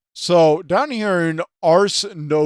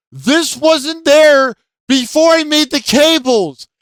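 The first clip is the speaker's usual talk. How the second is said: retold angry outburst; 8 semitones higher, 5 dB louder than usual. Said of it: "I'm like, pissed off".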